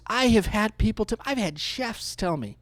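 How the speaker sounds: normal talking voice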